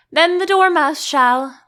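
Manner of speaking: a lively shout; cried out. A narrator's reading. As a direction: call it out together eagerly. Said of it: "they both cried".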